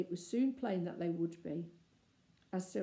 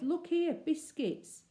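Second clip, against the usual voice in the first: higher, eager call to the others; eager cry; 8 semitones higher, 3 dB louder, slower than usual. Acted. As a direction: show off the biscuits with delight. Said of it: "he cried".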